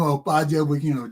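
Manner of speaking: ordinary talk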